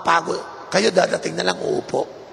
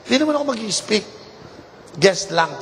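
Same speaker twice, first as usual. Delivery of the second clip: usual speaking voice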